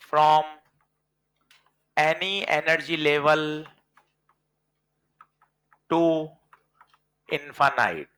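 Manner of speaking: very slow, long gaps between words; slow dictation. Shown as a demonstration. Teacher dictating the ionization definition for notes. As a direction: dictate at writing pace. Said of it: "देखो मैं लिखता हूं फिर बताना".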